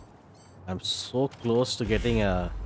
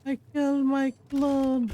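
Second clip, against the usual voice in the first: much higher, squeaky shocked whine; high squeaky disbelief; 3 dB louder than usual. Acted. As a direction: squeal it in mock horror. Repeats in high put-on voice.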